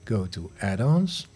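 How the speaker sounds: ordinary delivery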